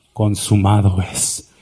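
slow, low, solemn last words; Jesus's final word. Acted. Jesus on the cross, slow and low. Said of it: "dio el golpe final".

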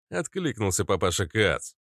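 plain reading voice

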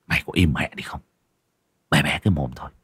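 low, soft order to shut up; gruff hushing. A narrator's reading. Husband silencing his shrieking wife, low gruff hushing. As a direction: hush her harshly under your voice.

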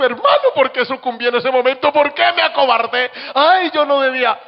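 high, loud anguished plea of a weeping man; a man reliving his shame, pleading; lamenting man. Retold or imitated. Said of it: "hombres que han llorado".

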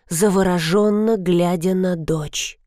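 ordinary delivery, nothing marked

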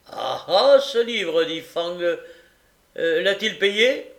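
loud, high, gotcha tone; triumphant magistrate. Acted. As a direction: pounce on the detail. Magistrate pounces on the book.

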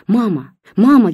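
высоко и громко, всё выше на втором; зовёт мать в отчаянии, срываясь на крик; отчаянный зов. A narrator's reading. Дочь бросается к матери. (дочь кидается к бабушке: громкий отчаянный зов)

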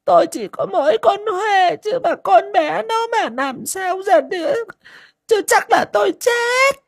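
a mother's desperate weeping; tearful cry. A narrator's reading. Mother Ngà fearing for her only daughter, crying. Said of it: "Mắt mụ đã ẩn ẩn nước".

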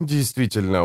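usual speaking voice

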